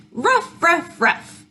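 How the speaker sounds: high, quick little-dog ruffs; high storybook puppy barks. A narrator's reading. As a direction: imitate a small dog barking. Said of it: "little dogs go".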